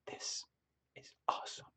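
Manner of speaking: breathy private whisper of awe. Retold or imitated. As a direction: whisper it to yourself. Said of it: "very quietly going like this".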